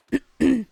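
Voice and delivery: short burst of loud laughter. Real, not acted. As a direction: laugh out loud at your own joke. Laughs after teasing partner.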